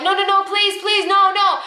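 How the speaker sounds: high, urgent begging; screamed pleading. Retold or imitated. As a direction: imitate a panicked boss crying out to stop someone.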